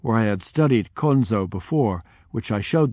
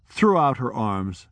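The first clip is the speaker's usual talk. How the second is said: usual speaking voice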